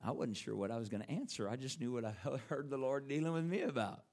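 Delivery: ordinary talk, nothing marked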